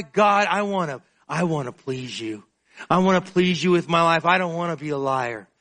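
earnest prayer spoken aloud; sincere pleading prayer; heartfelt prayer. Acted. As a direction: pray from the heart. Prays aloud to God.

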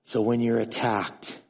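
a hint of God speaking gently; God's voice. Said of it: "the Lord spoke to me and he goes".

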